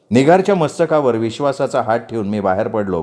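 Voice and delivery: plain reading voice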